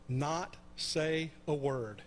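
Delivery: slow, deliberate reveal; slow punchline. Real, not acted. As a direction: drop each word slowly like a punchline. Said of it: "get this, you ready for it?".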